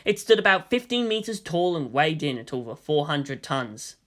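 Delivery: ordinary delivery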